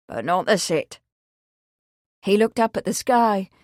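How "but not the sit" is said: a hint of a low old countryman; dry, low rustic refusal; old farmhand. Old Willie refusing to sit, low and dry. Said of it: "the old man said".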